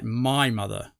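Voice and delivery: "My" stretched and stressed over "mother". Retold or imitated. Vlogger demonstrating Buffy's weird wording, emphatic "My". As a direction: put all the weight on "My". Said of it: "almost like that".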